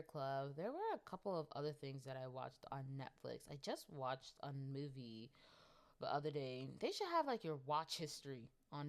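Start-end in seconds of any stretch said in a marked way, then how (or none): none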